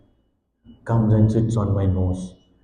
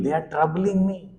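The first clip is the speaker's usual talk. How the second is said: ordinary talk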